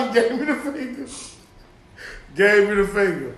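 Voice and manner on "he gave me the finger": mock complaint. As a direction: imitate the offended driver through laughter. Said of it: "He be like".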